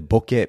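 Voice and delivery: "Bokeh" carefully said as BO-keh; Japanese pronunciation. Acted. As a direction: say "Bokeh" the Japanese way, short with an "eh" ending. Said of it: "should be pronouncing it that way".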